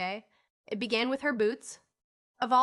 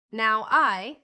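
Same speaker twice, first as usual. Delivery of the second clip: emphatic; 9 dB louder than usual. A narrator's reading. Old man asserting himself.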